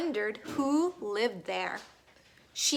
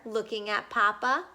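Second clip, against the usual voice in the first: usual speaking voice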